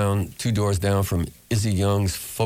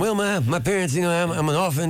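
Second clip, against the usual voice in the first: much higher, playful mock young folk singer; 10 semitones higher than usual. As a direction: spin a tall tale in a high put-on voice. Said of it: "And she goes".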